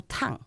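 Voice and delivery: "tang" falling sharply; fourth tone TANG. Shown as a demonstration. Student demonstrates Chinese tones, "tang" falling. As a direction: drop "tang" like a firm no. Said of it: "cuarto tono".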